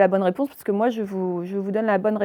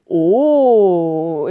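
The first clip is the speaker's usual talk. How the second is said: long, loud closed "oh"; admiring "oh" at bubbles; 3 semitones lower, 8 dB louder than usual. Acted. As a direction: draw out a round "oh" for pretty bubbles. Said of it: "Lorsqu'il fait des belles bulles". (long « oh » fermé, admiratif)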